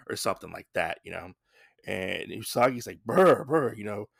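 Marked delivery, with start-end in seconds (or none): 3.0-3.7 louder, higher "brr, brr"; mock shivering noise; imitated shiver. Soaked Usagi in the hut, imitated shivering "brr". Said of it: "Usagi is soaked to the bone".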